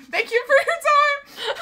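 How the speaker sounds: high, laughing thank-you; real laughter through the words; laughing sign-off. Real, not acted. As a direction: crack up while closing your speech. Cracks up ending own speech.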